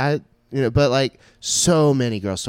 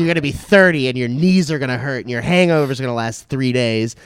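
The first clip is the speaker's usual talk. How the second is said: loud, raised-pitch comic tirade; exasperated rant; 4 semitones higher, 8 dB louder than usual. Real, not acted. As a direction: warn a younger friend at full volume. Older host rants at younger friend.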